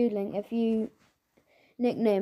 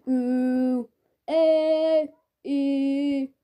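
loud, higher stretched vowel sounds; face warm-up vowels; 4 semitones higher, 6 dB louder, much slower than usual. Shown as a demonstration. Drama teacher's face exercises before class, exaggerated vowels. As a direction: pull faces through each vowel. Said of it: "these weird face exercises".

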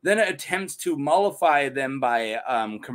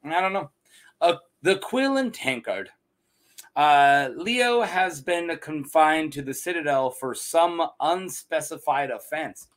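normal talking voice

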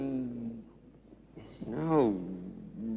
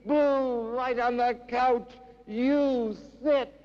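declaimed poem, climbing to a high, ringing pitch; dramatic recital with rising intensity; impassioned poetry reading; 9 semitones higher than usual. Acted. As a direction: start measured, build to fervent high-pitched recitation.

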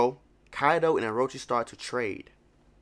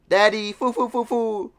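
mimicking Toko; 9 semitones higher, 5 dB louder than usual. Mimics crying girl from clip.

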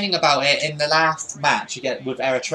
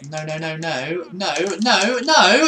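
mounting dismay. Real, not acted. Goalkeeper being beaten as he watches.

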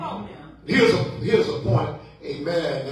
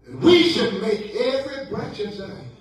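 emphatic preaching; 5 semitones higher, 3 dB louder than usual. Acted. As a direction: proclaim the sermon theme with stretched key words. Preaches his theme.

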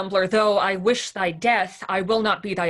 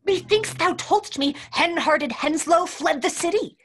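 much higher, louder frantic voice; panicked; 10 semitones higher, 5 dB louder than usual. Acted. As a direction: blurt it out in a panic. Kit panicked, intruders coming.

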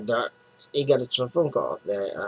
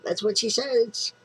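mock girl voice; 13 semitones higher than usual. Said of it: "Sorry if I insulted anybody".

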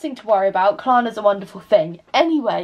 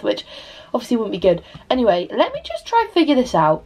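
ordinary delivery

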